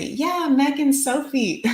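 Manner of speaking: high, drawn-out mock cheer; cheering viewer comment. Host voicing a viewer's comment from the chat, cheering. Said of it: "I just saw someone say".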